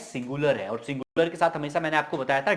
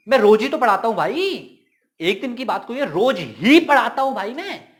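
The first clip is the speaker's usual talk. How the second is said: higher, louder, insisting he teaches every day; insistent emphasis; 6 semitones higher, 6 dB louder than usual. Shown as a demonstration. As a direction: push each phrase out with exasperated emphasis. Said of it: "अगर मैं इसको जोर देके बोलूं". (ऊँची, ज़ोरदार, झुंझलाई हुई ज़िद)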